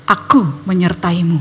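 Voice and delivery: low, calm divine reply; God answering. Retold or imitated. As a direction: answer deep and assured, as God. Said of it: "Tuhan kembali menjawab".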